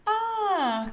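long, high, loud aah; a high, drawn-out aww of adoration; adoring cry. A narrator's reading. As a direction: coo out loud like delighted children. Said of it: "cried the children".

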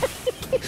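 high, wheezy cackle; squeaky fit of laughing; hearty laughter. A narrator's reading. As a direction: laugh until you cry. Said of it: "they laughed until they cried".

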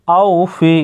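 "আউ ফি" with the "au" glide not drawn out; no elongation. Shown as a demonstration. Teacher shows learners the unstretched glide, "আউ ফি" without elongation. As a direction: say "আউ ফি" without stretching either vowel. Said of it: "এখানে টানা যাবে না".

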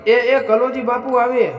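high, loud, slow cry of relief; crowd cry. A narrator's reading. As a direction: call out from far across the village edge. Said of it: "એક સામટી ચીસ પાડી".

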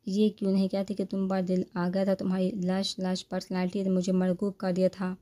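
plain reading voice

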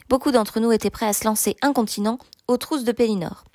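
ordinary delivery

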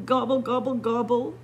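a lightly voiced wail, only a hint of lament; mild wail. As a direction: gobble it out as a mock-sorrowful wail. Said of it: "wailed Turkey".

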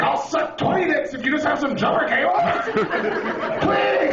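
high-pitched, hammed-up pleading shout; desperate begging. Stranded driver offering anything for help, desperate begging. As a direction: beg at the top of your range.

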